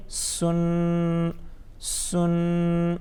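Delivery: سن with a sharp, hissed s; firm s, long n in سن; whistling seen. Shown as a demonstration. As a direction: chant سن on one note, s hard and whistled. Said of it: "سی ٹی والی آواز بھی آ جانی چاہیے".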